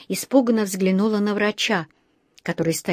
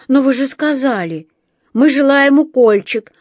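protesting bear; 5 semitones higher, 7 dB louder than usual. A narrator's reading. Bear reminding the dentist what he promised, protesting. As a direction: pipe up in higher, louder protest. Паддингтон возражает врачу.

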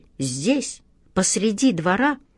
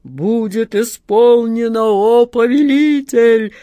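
loud, slow, raised booming voice; thundering genie; 5 semitones higher, 6 dB louder, slower than usual. A narrator's reading. Genie obeying his master, in a slow thunderous boom. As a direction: roar it out with long, rolling vowels. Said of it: "загрохотал Джин".

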